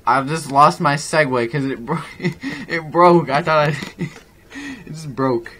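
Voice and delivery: real, high, loud laughing fit; words bursting out between laughs; uncontrollable laughter. Real, not acted. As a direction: laugh helplessly through the words. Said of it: "sorry if I just uncontrollably laughed".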